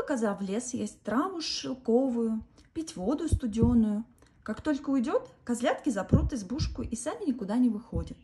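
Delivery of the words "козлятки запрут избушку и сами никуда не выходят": ordinary delivery, nothing marked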